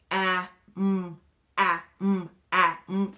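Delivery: "ah, mm" as separate choppy sounds; choppy sounding out. Retold or imitated. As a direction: break "ah" and "mm" into chunks like a child. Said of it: "you'll hear children read".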